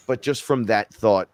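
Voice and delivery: ordinary talk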